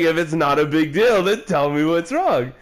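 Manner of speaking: high, pressing retold plea; exasperated demand. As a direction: re-enact pressing an upset partner for answers. Retelling his pleading in a quarrel.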